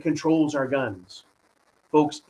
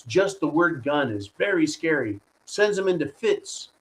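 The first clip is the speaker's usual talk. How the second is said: ordinary talk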